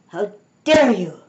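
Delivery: loud, indignant outburst; angry shout. A narrator's reading. Soaked Miss Alma rounds on them, shouting angrily. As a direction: punch it out loud and outraged. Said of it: "she shouted".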